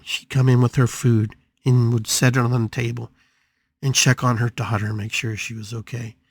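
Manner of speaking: ordinary delivery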